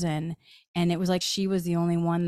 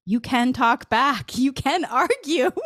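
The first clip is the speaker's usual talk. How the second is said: higher and louder, laughing through it; laughing delight; 6 semitones higher, 4 dB louder than usual. Real, not acted. Telling listeners they can argue with guides, laughing.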